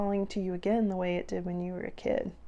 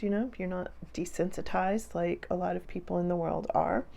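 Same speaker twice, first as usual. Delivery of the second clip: ordinary talk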